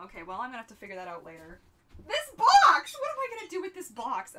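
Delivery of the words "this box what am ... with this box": sudden high yell, much louder; panicked shout. Real, not acted. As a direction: blurt it out in alarm. Panicking over where to hide gift.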